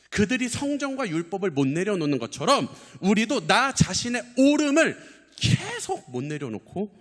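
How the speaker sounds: high, loud preaching cry; impassioned preaching. Real, not acted. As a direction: preach it at full pitch, pressing every phrase. Preacher at full force.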